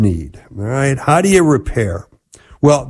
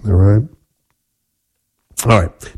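usual speaking voice

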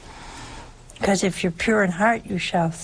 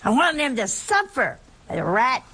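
higher-pitched, mock-indignant widow; retold anger; 4 semitones higher than usual. Retelling a mad widow's answer about her dead husband, mock angry. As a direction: imitate a woman bitter at her late husband. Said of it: "She was so mad".

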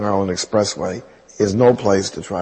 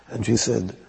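usual speaking voice

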